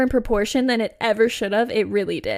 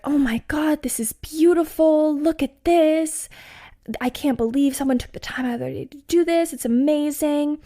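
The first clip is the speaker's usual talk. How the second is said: high, airy gushing over the rocks; gushing friend; 5 semitones higher than usual. Retold or imitated. Imitating her friend marveling at the rock statues, high and gushing. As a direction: coo over them like a smitten fan.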